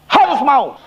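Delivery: high, insistent exorcist's order; forceful command. Retold or imitated. Exorcist quarrels with possessed man. (perintah tegas bernada tinggi)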